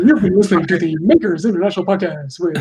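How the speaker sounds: radio host outro voice; big announcer sign-off. Pretend. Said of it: "don't do the D J voice".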